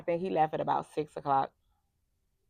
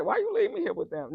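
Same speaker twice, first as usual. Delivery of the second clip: much higher whiny plea; sister's plea; 14 semitones higher than usual. Retold or imitated. Sister-in-law begging to be picked up, high whine. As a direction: pitch it up like a pleading kid. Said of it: "And so she said".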